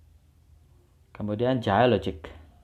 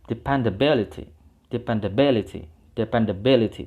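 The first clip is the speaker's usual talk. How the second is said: Dependability with bi stressed, a bit louder; 3 dB louder than usual. Shown as a demonstration. Teacher drilling Dependability for students, stress on bi. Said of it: "di B-nya".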